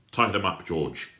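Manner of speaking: loud, curt command to a henchman; barked order. Acted. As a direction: order him to tie them up, loud.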